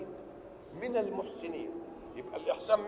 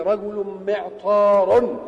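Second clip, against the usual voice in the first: رجل معطار said slow and loud; emphatic example; 11 dB louder, much slower than usual. Shown as a demonstration. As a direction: boom out رجل معطار slowly. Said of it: "تقول إيه؟".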